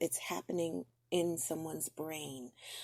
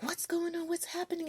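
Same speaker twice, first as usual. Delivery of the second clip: alarmed cousin; 12 semitones higher than usual. Retold or imitated.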